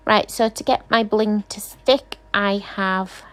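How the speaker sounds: ordinary delivery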